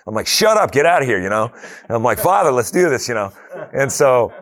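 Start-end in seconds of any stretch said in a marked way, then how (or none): 0.3-1.2 high, loud retold dismissal; forceful rebuke. Rebuking the devil's voice in retelling. 2.2-3.1 high, loud rallying prayer; fired-up appeal. Retold or imitated. Retold eager appeal to God.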